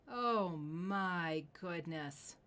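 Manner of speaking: slow, stretched amazement; long amazed exclamation; drawn-out awe. Real, not acted. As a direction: let the wonder stretch out. Host awed by ring.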